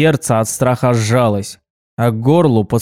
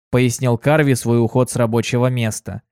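ordinary delivery

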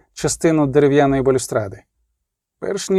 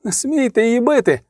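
crying out; 7 semitones higher than usual. A narrator's reading. Lover protesting as the woman is struck, crying out. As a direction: cry out a desperate prohibition, pitch up. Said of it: "закричав Рейневан".

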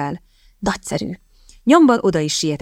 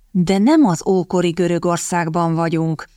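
ordinary delivery

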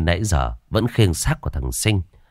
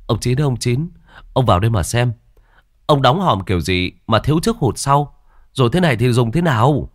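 a touch of half-crying, higher pleading; whimpering plea; 6 semitones higher, 3 dB louder than usual. A narrator's reading. Young man begs the coffin maker to look, mildly whimpering. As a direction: complain on the edge of tears. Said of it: "một thằng mếu máo".

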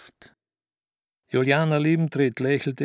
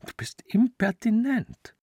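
whisper; 9 semitones higher than usual. Acted. Juliana offended, whispering.

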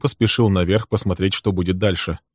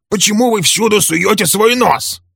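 high, loud lashing out; angry attack; 14 semitones higher, 5 dB louder than usual. A narrator's reading. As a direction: Walter attacking Bill, angry. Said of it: "набросился Вальтер на Билла".

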